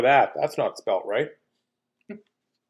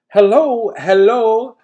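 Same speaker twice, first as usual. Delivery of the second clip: loud greeting; 9 semitones higher, 8 dB louder, slower than usual. Real, not acted. Greeting a viewer loudly.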